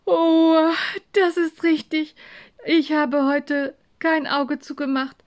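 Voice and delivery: high-pitched yawn running into the words; yawning, tired. Acted. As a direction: play a worn-out child who yawns as she talks. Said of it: "Farah gähnte".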